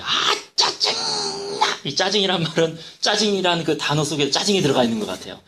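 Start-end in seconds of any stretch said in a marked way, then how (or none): 0.0-1.8 drawn-out, exasperated mock outburst; annoyed outburst. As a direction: act out real irritation, stretching the words way out. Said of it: "진짜 짜증을 부리라". (짜증을 잔뜩 부리며 길게 내뱉듯)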